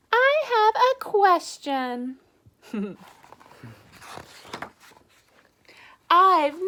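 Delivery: a sweet, curious little duckling asking. Acted. Duckling asks pigeon.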